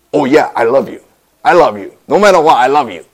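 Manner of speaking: loud, sure declaration of love; confident. Acted. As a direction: say it with full assurance, no doubt. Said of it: "Peter confidently says".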